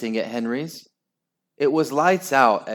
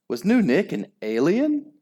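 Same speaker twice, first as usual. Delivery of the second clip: ordinary delivery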